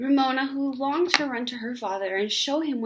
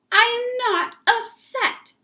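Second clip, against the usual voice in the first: much higher, louder furious yell; 7 dB louder, slower than usual. Acted. Ramona to her mother, furious yell. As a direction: scream the denial at them. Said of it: "yelled Ramona".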